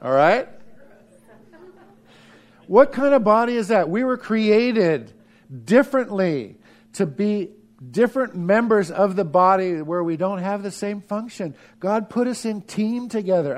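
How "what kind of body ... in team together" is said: fervent preaching. Acted. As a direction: press the point to the congregation. Preaching at full force.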